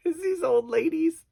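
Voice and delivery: high, squeaky voice breaking into laughter; laughing while talking. Real, not acted. Wife recalling old ladies at the drugstore, laughing. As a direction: struggle to talk through the giggles. Cracking up at her own story.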